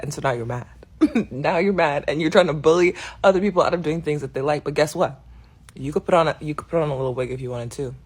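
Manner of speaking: ordinary talk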